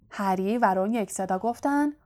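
ordinary delivery, nothing marked

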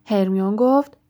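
ordinary delivery

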